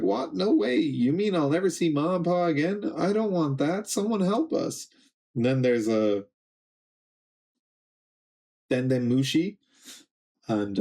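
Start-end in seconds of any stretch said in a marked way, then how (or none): 0.0-4.9 high-pitched mock kids' protest; dismayed kids. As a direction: plead like alarmed kids, voice pitched up. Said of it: "And the kids are like".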